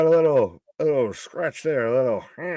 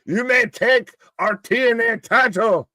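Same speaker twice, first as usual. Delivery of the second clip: high-pitched, hammed-up battlefield oration; mock-heroic rallying speech; Braveheart speech; 8 semitones higher than usual. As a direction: declaim it like a rebel leader before battle. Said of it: "What is Braveheart?".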